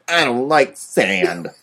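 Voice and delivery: slow, lightly put-on sulky actor voice; Hayden Christensen's Anakin. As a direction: drag the words out in a mild impression. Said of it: "That's my Hayden Christensen Anakin impression".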